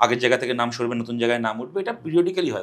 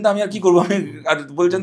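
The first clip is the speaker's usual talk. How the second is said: usual speaking voice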